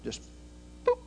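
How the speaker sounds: usual speaking voice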